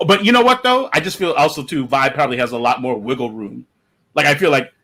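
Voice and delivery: ordinary delivery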